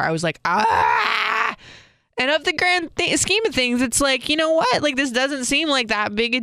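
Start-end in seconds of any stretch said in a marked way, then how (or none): 0.4-1.6 King Kong scream. Retold or imitated. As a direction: let out a sudden frustrated scream. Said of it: "I sounded like King Kong in my car".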